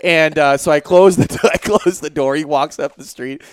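ordinary talk

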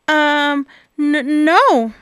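nervous stammer on each word; stuttering. Acted. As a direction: stammer it out, scared. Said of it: "stuttered Bob".

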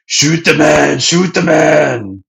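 loud high yelled order; shouting Krieg. Retold or imitated. Krieg yelling at Gin to shoot the old man, loud and high. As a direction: bark the order like a furious pirate captain. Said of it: "Krieg's like".

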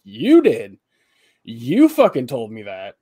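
loud, emphatic retold accusation; exaggerated retort. Worker throwing boss's words back at him, exaggeratedly indignant. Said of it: "I didn't say it like that".

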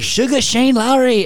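loud, high, stretched-out nickname call; hype announcement. Acted. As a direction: belt out the nickname like a hype man. Hyping a player's nickname.

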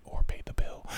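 hushed confession under the breath; sheepish whispered aside. Real, not acted.

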